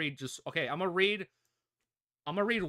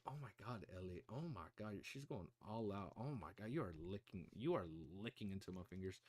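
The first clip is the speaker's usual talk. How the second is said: much softer, low cooing to puppy; hushed cooing; 9 semitones lower, 14 dB quieter than usual. Real, not acted. Owner murmuring to her puppy licking her fingers.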